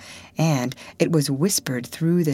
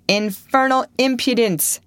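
high, scornful exclamation; indignant bystander; 8 semitones higher than usual. A narrator's reading. Bystander scorning Potter's nerve, high and indignant. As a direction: sneer at his nerve. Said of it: "said a bystander".

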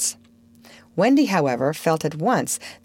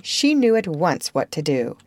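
ordinary delivery, nothing marked

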